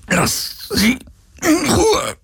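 high, strained plea; forced out in pain. A narrator's reading. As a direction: struggle to get each word out. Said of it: "brachte Galen hervor". (gequält und hoch hervorgepresst)

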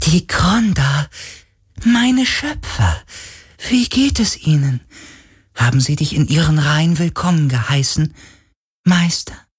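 ghost voice. Acted. Ghost speaks slowly, high.

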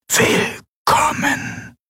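slow, unvoiced ghostly whisper; geisterhaftes Flüstern. A narrator's reading. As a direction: whisper it like a voice inside her head. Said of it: "eine Stimme in ihren Gedanken flüstern". (langsames, tonloses Geisterflüstern)

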